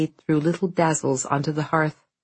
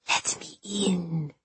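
slow, much softer eerie hiss; hissing ghost; 2 semitones higher, 8 dB quieter, slower than usual. Acted. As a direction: hiss it from beyond the window. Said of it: "hissed the thing".

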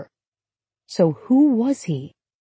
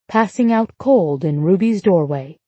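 ordinary delivery, nothing marked

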